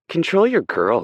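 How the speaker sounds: high, loud, sharp snap; angry snap. Acted. Aspen snaps at Kay about Hurricane, loud and sharp. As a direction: snap the order at him. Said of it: "Aspen snaps".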